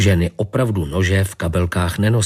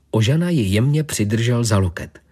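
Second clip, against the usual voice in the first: usual speaking voice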